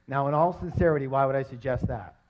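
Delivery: ordinary delivery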